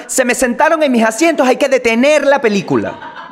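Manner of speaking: high, indignant and demanding, half-laughing; mock the woman demanding they stop the film; whiny outraged moviegoer, hammed up; pushy entitled lady. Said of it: "La tipa llegó de la nada".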